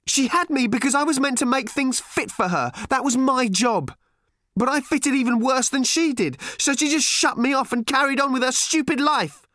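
high, loud scornful rant; bitter ranting girl. A narrator's reading. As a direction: hammer each stupid harder.

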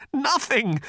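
high, earnest insistence; insisting Arthur. A narrator's reading. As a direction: protest your innocence. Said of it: "insisted Arthur".

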